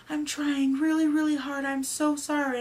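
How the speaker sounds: high, loud, pleading apology; flustered pleading. Retold or imitated. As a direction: re-enact an overwhelmed patient apologizing. Overwhelmed patient apologizing while shaking.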